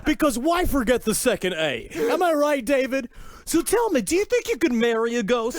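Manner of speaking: George Noory. Acted. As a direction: lean into eager, high-pitched mock curiosity. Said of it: "a perfect George Noory impression".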